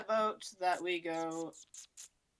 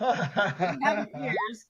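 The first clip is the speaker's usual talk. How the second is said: laughing; 3 semitones higher, 4 dB louder, slower than usual. Real, not acted. Laughing while protesting to GM.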